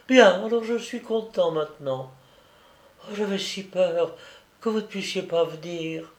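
put-on a sick child comforting his big sister; dying little boy. Dying child speaks, held voice. (petit garçon mourant, voix d'enfant douce et fragile)

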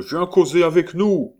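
loud, high-pitched holler; hoarse man's shout. Acted. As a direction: shout an invitation across the house. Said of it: "cria la voix rauque". (cri d'une voix rauque)

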